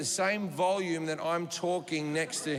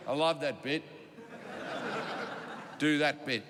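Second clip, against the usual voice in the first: gruff billionaire; 6 semitones lower, 3 dB quieter than usual. Retold or imitated.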